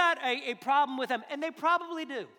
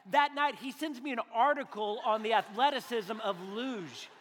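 ordinary talk

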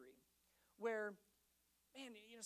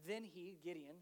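ordinary talk, nothing marked

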